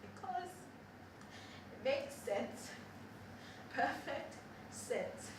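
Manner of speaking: soft whispered musing; whispered private reflection; quiet musing. Acted. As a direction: hush it to yourself.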